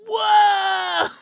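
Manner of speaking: sudden loud high whoa; startled whoa. Real, not acted. Streamer startled by something on screen, shouting whoa. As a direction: burst out in surprise.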